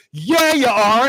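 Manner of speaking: high, loud cheer; friend shouting support. Retold or imitated. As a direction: shout it running over for a high five. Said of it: "comes running over and goes".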